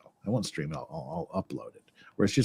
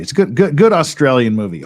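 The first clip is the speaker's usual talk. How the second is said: normal talking voice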